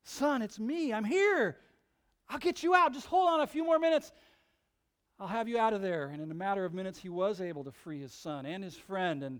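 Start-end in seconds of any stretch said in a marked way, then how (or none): 0.0-6.1 put-on high, loud, urgent promise to the boy; urgent reassurance. Father calling to his trapped son. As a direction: call down fast to keep him going.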